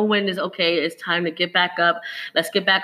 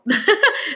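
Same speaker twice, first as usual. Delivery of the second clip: bright little laugh; short laugh; 10 semitones higher than usual. Real, not acted. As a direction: laugh it off lightly. Laughs at own pushiness.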